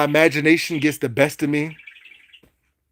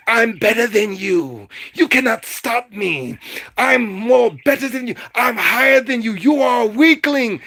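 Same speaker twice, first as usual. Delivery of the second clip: hammed-up mock bragging; boastful evil witch; 4 semitones higher than usual. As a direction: gloat like a cartoon baddie who thinks they're untouchable. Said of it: "this is what we do to evil people".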